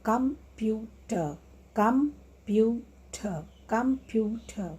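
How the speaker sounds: "computer" slowly split into com, pu, ter; "computer" in three separate beats, com... pu... ter; syllable by syllable. Shown as a demonstration. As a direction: pause after each syllable of "computer". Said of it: "three syllables word".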